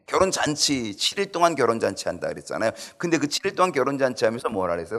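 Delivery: ordinary talk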